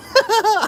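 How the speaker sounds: a short, very high burst of laughter. Real, not acted. Streamer laughs at his pet's reaction, squeaky laugh.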